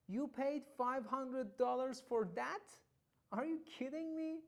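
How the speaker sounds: high, loud disbelief for a demo; incredulous. Shown as a demonstration. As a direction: act out shocked disbelief, pitch jumping up. Acted disbelief at a price.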